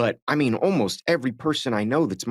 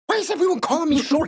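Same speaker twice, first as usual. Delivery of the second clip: much higher, exasperated cry; indignant outburst; 3 dB louder than usual. Retold or imitated. Actor replays his unscripted protest, high and exasperated. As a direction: burst out in mock indignation at being called short. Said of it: "as Derek went".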